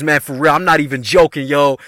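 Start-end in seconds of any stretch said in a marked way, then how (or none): none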